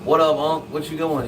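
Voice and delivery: lightly acted doorstep hello; lively mock greeting; retold casual greeting. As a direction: greet him a little up in pitch.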